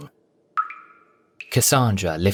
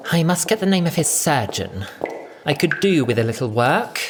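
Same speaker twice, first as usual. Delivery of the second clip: higher, huffy and vain; huffy muttering; 7 semitones higher than usual. A narrator's reading. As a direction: sulk at the mirror, grumbling. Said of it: "muttering huffily to herself".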